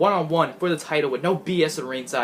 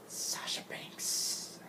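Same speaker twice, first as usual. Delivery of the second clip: a breathy whispered ring-announcer call; whispered announcement; 17 dB quieter, much slower than usual. Pretend. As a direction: whisper the champion's name like an announcer. Ring announcer style title call.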